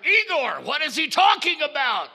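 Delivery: high, loud alarm; panicked doctor. Acted. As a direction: yell to Igor in confusion.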